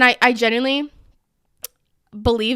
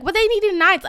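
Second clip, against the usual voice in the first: mock disbelief; 9 semitones higher than usual. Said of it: "And everyone's like".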